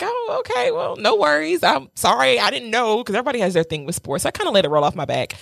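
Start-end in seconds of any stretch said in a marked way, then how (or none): 0.0-3.0 sweet apology. Retold or imitated. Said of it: "I was like".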